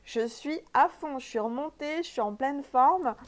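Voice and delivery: bursting with new moon energy, laughing into it; real excitement, pumped and loud; fired up. Real, not acted. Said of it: "vous devez sentir à ma voix".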